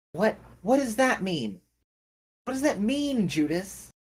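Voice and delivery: high, frantic questioning; rising panic as it sinks in; old lady confronting Judas in fear; panicked. Acted.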